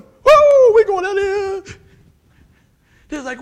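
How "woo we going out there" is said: loud, high whooping cheer from the guys; coworkers whooping. Retold or imitated. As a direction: shout it out like rowdy coworkers cheering. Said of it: "They going".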